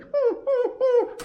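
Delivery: very high hooting dog whine; dog whimper. Retold or imitated. Voicing the dog as he leaves, a high whine. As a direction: whine like a dog left behind. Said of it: "He's like".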